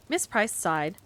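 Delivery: usual speaking voice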